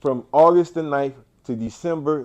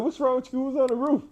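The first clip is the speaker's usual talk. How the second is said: very high, baffled retold questions; incredulous; 11 semitones higher than usual. To the nurse avoiding his eyes, high baffled questions. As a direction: recreate the shock, voice pitched high and pleading.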